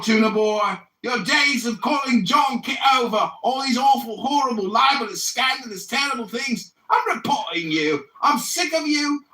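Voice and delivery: put-on an English accent huffing that it won't tolerate this; mocking English accent rant. Storming off the Clubhouse call at Tuna Boy in a mocking English accent. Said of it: "my English accent is so mocking".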